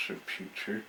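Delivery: plain reading voice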